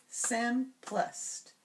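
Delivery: SIMPLEST very slow, in separated beats; syllable by syllable. Shown as a demonstration. Teacher confirming the changed word, simplest slowly split. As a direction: break simplest into sim, plest. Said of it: "change the final syllable of".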